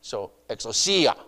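exousia said as ek-soo-SEE-a, stress on the third syllable; Greek pronunciation. Shown as a demonstration. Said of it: "the emphasis in the pronunciation on the C".

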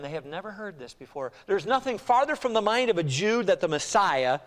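normal talking voice

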